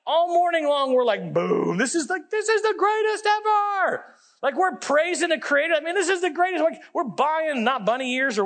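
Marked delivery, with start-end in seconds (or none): 1.3-4.0 mock rapture. Excited group praising the guide book.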